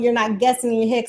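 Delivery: normal talking voice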